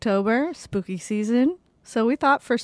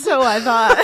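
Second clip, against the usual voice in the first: laughing while echoing; giggling through the words; 11 semitones higher, 7 dB louder than usual. Real, not acted. Mocking friend's words, laughing.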